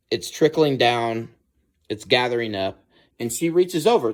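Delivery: usual speaking voice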